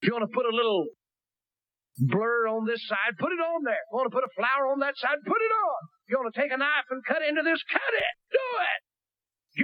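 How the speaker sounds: high, building to a shout; preaching at full force. Acted. Preacher climaxes the sermon, building to shout. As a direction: hammer it home to the congregation.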